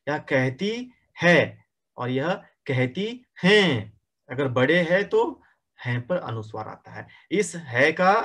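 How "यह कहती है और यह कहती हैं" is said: very slow, हैं nasal and है plain; है with no nasal, हैं with a nasal ending; hai versus hain. Shown as a demonstration. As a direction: slowly set है against the nasal हैं. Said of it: "दोनों में अंतर दिखाई दे रहा है".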